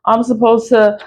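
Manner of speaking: retelling her own trusting reply in a sing-song voice; mock naive self. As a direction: play yourself as naive and obliging. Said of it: "I'm like".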